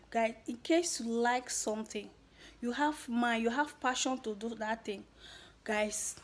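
ordinary talk

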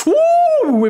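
very high, loud whoop; loud celebratory whoop. Real, not acted. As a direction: let out a big goal whoop. Celebrating a goal.

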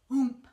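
loud landing grunt oomp; a winded oomp as he hits the ground. Acted. Wilbur lands with a thump, loud grunt. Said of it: "he grunted".

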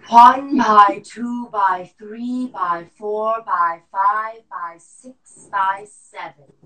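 slow loud forced count; lung function test. Shown as a demonstration. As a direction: push the breath out counting. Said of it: "breath count drill".

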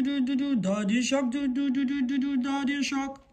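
ordinary delivery, nothing marked

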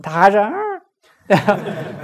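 ordinary talk

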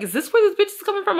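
spooked, asking out loud; a high alarmed question at night. Retold or imitated. Startled inner thought.

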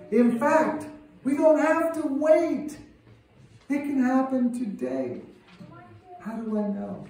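fiery preacher building up; preaching. Acted. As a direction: preach it high and loud. Rising sermon climax.

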